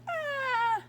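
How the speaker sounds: sudden high yelp of alarm; startled cry. Acted. Reader crying out as Harold slips, high startled yelp. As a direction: cry out as if slipping off a cliff.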